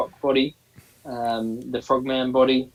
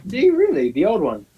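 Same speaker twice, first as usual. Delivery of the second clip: high-pitched, amazed question; real surprise; 6 semitones higher, 3 dB louder than usual. Real, not acted. Co-host hears he has got Mole Man, surprised. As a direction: leap up high in genuine surprise. Co-host learns he owns figure.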